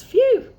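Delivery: loud high puff of relief; exaggerated phew. The squirrel puffs after counting, loud high phew. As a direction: blow out a big phew.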